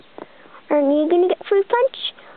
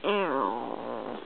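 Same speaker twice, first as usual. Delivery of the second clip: low sad voice; 10 dB quieter than usual. Pretend. [name] answers in other voice.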